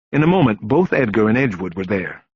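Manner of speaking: plain reading voice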